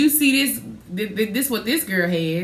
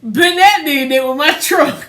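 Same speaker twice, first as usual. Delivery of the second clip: loud, higher, slow complaint; loud, raised protest about the truck; heated interjection; 7 semitones higher, 8 dB louder than usual. Real, not acted. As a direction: cut in loudly to make the point. Heated family story interjection.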